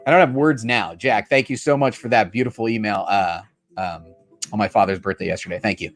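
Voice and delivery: low, halting, emotional thanks; choked up. Real, not acted. Streamer thanking a viewer for an email on his late father's birthday, choked up. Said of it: "it brought me to tears".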